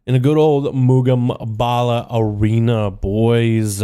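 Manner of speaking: high, booming arena announcer. Acted. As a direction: hype up the match like a ring announcer.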